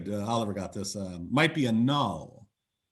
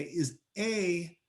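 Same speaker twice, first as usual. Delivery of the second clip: drawn-out question; 4 semitones higher, much slower than usual. Retold or imitated. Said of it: "they would say".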